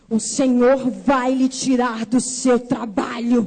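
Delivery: slow, low, weighty pronouncement; solemn prophecy. Retold or imitated. Church sister prophesying to a girl's face, solemn and slow. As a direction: deliver it as God's own verdict, word by word. Woman prophesying at her, finger pointed.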